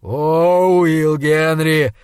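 drawn-out, mild exclamation; a touch of anguished outcry; crying out. A narrator's reading. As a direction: cry out slowly, stretching the name. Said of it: "вскричал он".

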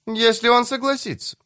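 very high character voice; high-pitched voice replying on the phone. A narrator's reading. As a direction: keep it high and even to the end. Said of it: "высокий голос".

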